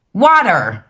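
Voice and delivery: British woman faking a twangy American "Water"; mock American accent. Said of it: "they do American accents".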